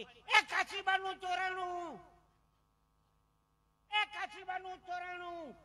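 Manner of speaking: high, very loud drawn-out cry; sudden alarmed yell. Acted. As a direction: raise the alarm at full volume, stretching the words. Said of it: "એ ઓચિતાની બૂમ પાડી".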